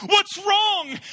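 high, loud alarmed cry; jolted awake and yelling in alarm; startled wife. Retold or imitated. Wife woken by frantic husband, high alarmed shout. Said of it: "She says".

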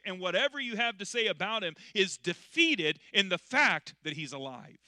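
loud, rising sermon peak, driving every line; preaching at full force. Acted. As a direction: preach it out loud to the whole church.

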